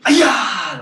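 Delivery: loud, high martial arts kiai; sharp karate-class battle cry; loud yell. Shown as a demonstration. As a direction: yell it out high, falling away at the end. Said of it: "Loud yell".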